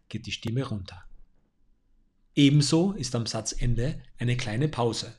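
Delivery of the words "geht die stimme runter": "geht die Stimme runter" dropped lower and softer, as at a full stop; voice going down. Shown as a demonstration. Speaking coach showing the voice falling at a full stop. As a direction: let the pitch fall away on "runter". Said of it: "den Satz mit einem Punkt beendest".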